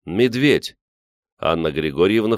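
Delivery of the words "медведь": high, alarmed exclamation; calling out. A narrator's reading. Grisha spotting a bear outside, calling out. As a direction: sound the alarm in one word. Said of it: "выкрикнул Гриша".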